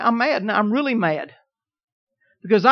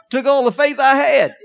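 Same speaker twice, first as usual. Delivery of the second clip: choked-up, louder confession; tearful; 4 semitones higher, 6 dB louder than usual. Real, not acted. Preacher to church on reconciling with wife, near tears. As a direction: voice catching as he says it. Emotional testimony, cry heard.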